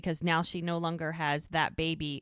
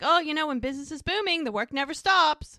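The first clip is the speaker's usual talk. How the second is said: smug brag; 8 semitones higher, 5 dB louder, faster than usual. Retold or imitated. Smug boyfriend brags about business.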